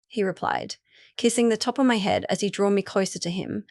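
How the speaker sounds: usual speaking voice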